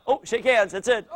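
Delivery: usual speaking voice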